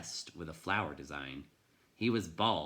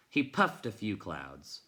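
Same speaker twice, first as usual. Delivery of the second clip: ordinary delivery, nothing marked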